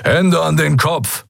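high, slow shouted command; demanding order. A narrator's reading. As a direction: order them firmly, drawing out each word. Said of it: "forderte ein dunkelhäutiger Mensch".

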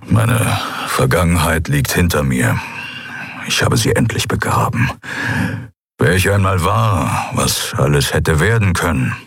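a brooding fighter admitting what he is; Keru, grim. A narrator's reading.

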